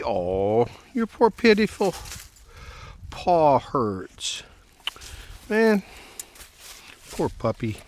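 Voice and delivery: cooing, sympathetic pet talk. Real, not acted. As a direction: coo to the hurt puppy. Cooing to injured dog.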